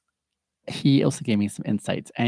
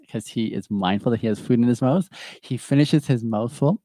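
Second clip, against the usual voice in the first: ordinary delivery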